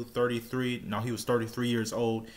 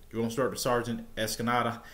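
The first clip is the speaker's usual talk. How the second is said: ordinary talk, nothing marked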